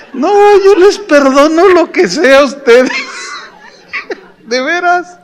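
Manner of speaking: agudo, con la risa en la voz; bromeando entre risas. Real, not acted. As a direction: perdona en broma a su público, riendo. Bromea riendo con la congregación. (broma aguda entre carcajadas)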